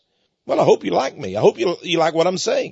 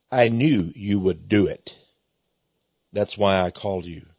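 God's voice; 6 semitones lower than usual. Retold or imitated. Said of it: "He said".

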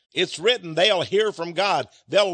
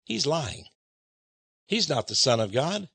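lower, softer mock sneer; scoffing crowd; 6 semitones lower, 5 dB quieter than usual. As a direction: lightly play the murmuring doubters. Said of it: "They said".

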